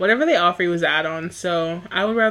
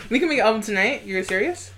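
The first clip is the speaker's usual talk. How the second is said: ordinary delivery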